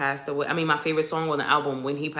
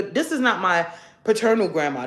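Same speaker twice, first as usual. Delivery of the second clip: ordinary talk, nothing marked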